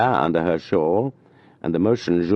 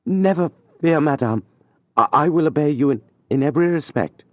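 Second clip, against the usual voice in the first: higher, stumbling over I, I, a touch of a sigh; shy young man faltering mid-promise; 9 semitones higher than usual. A narrator's reading. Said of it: "with a sigh, in a faltering voice".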